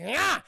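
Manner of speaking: short, high, loud shriek; mock scream. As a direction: let out a sudden loud cry. Imitates woman's cry.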